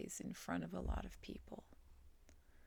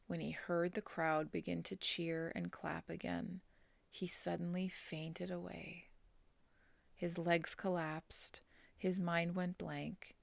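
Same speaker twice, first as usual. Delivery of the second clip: plain reading voice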